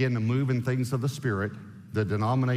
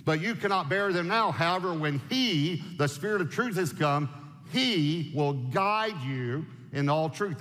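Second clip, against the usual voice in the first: slow, deliberate scripture reading; 5 semitones higher, slower than usual. Acted. Preacher reading the verse aloud, slow and measured. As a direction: lay the verse out word by word.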